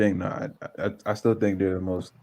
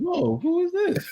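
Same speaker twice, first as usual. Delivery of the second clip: laughing disbelief; slower than usual. Retold or imitated. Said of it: "I'm like".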